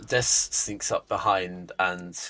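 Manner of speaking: low, soft, slow scene setting; game master narration. A narrator's reading. Game master describes the rogue's kill, hushed narration. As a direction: narrate the kill quietly and deliberately.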